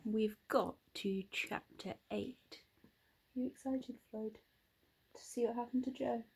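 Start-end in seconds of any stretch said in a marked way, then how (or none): 3.3-6.2 fast unvoiced whisper. Real, not acted. Teasing Floyd about the story ahead in a whisper. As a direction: hush it like a secret. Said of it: "reading to Floyd".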